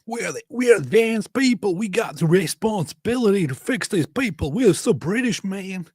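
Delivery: high put-on smug British voice; mock posh Brit. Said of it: "Not sure why they're talking like this".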